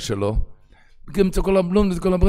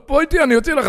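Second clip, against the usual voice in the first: coaxing; 5 dB louder, much faster than usual. Acted. Principal coaxing the boy.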